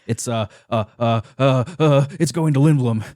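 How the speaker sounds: halting, stalling with uh's; badly faked nonchalance, stumbling over the words; bad liar. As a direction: fumble for a cover story. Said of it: "profoundly funny display of being a bad liar".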